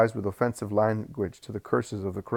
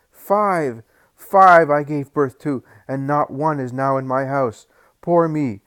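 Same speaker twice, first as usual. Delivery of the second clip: high, loud wailing cry; grieving mother's shriek; 5 semitones higher, 8 dB louder, slower than usual. Acted. Bereaved mother shrieks at the crowd in high wailing grief. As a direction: shriek out the loss of her sons. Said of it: "the shrill voice of a woman shouts".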